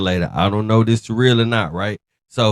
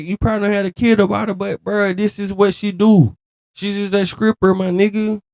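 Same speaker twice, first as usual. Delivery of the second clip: heated rant; 9 semitones higher than usual. Real, not acted. Heated rant lecturing Tank.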